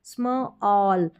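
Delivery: स्म all slowly in two parts, "smuh... awl"; blending by parts. Shown as a demonstration. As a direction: sound out the cluster स्म, then stretch all. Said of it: "ये उच्चारण है स्म".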